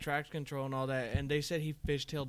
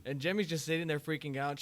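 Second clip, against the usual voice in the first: usual speaking voice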